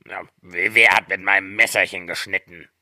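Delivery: lightly voiced stammering dwarf; sixth dwarf. A narrator's reading. Sixth dwarf asks about his knife, halting dwarf voice. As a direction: stumble on the first word, in character. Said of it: "Der sechste".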